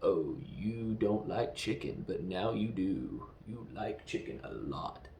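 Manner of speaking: slow, low hypnotist voice; Blofeld. Acted. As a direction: drop deep and draw the words out, as if planting a suggestion. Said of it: "you hear Blofeld's voice".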